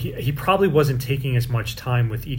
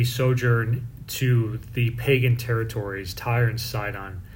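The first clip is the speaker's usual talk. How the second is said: ordinary talk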